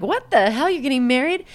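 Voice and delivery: high, startled "you're getting married"; shocked disbelief. Retold or imitated. Coworker shocked to hear Lucy is getting married. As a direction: gasp it out in disbelief.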